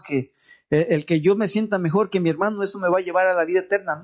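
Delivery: normal talking voice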